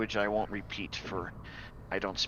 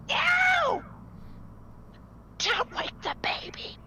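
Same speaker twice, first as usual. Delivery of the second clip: high ghostly shriek fading to a whisper; mournful keening wail; slower than usual. Acted. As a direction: shriek it like a grieving ghost, then breathe it. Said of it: "a shrieking woman's voice going".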